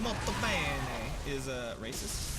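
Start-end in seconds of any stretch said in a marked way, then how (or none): 0.0-1.1 slow, drawn-out mock Italian; exaggerated Italian flourish; Italian accent. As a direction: stretch it in a stagey Italian accent. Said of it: "are you saying".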